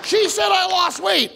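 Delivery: high, slow indignant yell; outraged repeat. Retold or imitated. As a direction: spit it out in disbelief, slowly. Outraged retelling.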